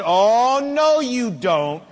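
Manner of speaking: emphatic, slow acted scolding; in-your-face father. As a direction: lay down the law like a dad confronting his child. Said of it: "got in their face and said".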